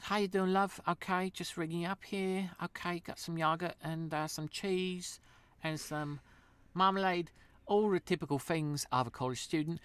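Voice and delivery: high-pitched Essex checkout chat; Essex accent. Retold or imitated. Essex cashier ringing up a student's shopping, high Essex accent. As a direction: put on a lilting, high Essex voice. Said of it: "in line in Essex, they're like".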